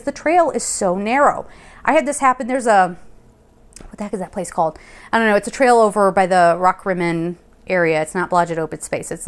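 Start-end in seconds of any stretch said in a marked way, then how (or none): none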